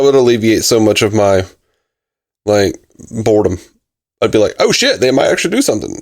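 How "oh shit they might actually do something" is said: high, rushed mock-excited reaction; mock excitement. Viewer imagining the show finally taking a risk, mock excitement. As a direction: blurt it out with pretend surprise.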